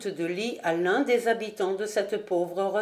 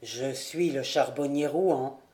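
simply; 7 semitones lower than usual. A narrator's reading. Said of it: "répondit Pélo avec simplicité".

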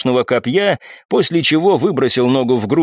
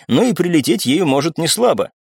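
plain reading voice

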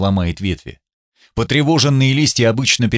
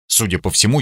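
usual speaking voice

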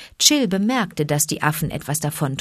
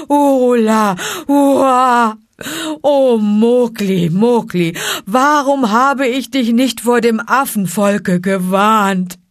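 loud, higher lament; wailing bear; 4 semitones higher, 6 dB louder than usual. Acted. Baloo wailing for Mowgli, loud and higher. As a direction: howl in grief for the boy.

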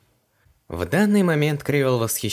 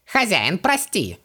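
high, begging little genie; pleading; 8 semitones higher, slower than usual. A narrator's reading. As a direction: beg the master for mercy. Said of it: "взмолился Джин".

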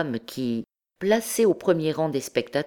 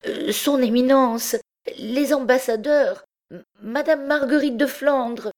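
high, trembling, hesitant stammer; 10 semitones higher than usual. A narrator's reading. Frightened Jupiter facing the hostile crowd, stammering. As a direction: falter and stumble, afraid of the crowd. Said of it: "il saluait et tremblait en balbutiant".

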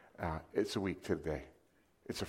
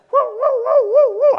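high, loud "woo woo" police siren; mimicking a cop car siren; siren imitation; 20 dB louder, slower than usual. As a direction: wail like a siren.

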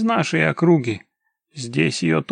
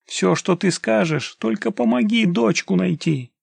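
higher, imploring entreaty; lamenting plea; 6 semitones higher than usual. A narrator's reading. Father begs for help finding his daughter, lamenting in a raised pitch. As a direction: keep begging plaintively. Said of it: "продолжал причитать мужчина".

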